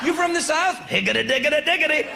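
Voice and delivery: a mock Southern twang; mock Southern accent. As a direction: mimic a Southerner talking down to him. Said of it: "they want to do your accent to you".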